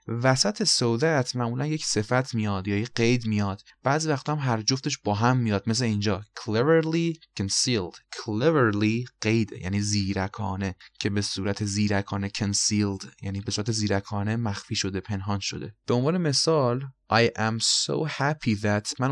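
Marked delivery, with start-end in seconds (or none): none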